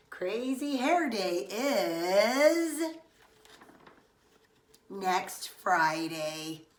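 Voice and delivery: stretched slow, loud announcement; slow, drawn-out reveal. Acted. Teacher announcing the real date, slow and loud. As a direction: drag it out so the news lands hard. Teacher's words shock Stanley.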